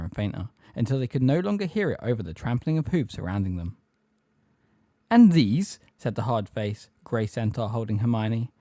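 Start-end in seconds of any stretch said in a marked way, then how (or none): 5.1-5.8 raised, loud question; stern demand. A narrator's reading. Grey centaur holding Hermione, stern demand. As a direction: demand a verdict on the captives.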